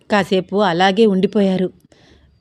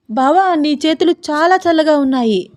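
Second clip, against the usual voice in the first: sudden raised exclamation; cry out; 7 semitones higher than usual. Acted. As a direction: yelp at the cold touch. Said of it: "అలా అరవకే".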